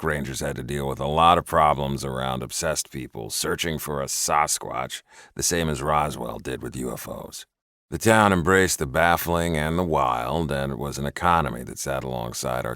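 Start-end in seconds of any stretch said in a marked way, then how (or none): none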